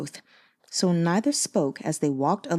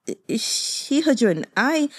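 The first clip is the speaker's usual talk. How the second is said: hurt mumble; 5 semitones higher, slower than usual. Acted. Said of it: "mumbled, confused and hurt".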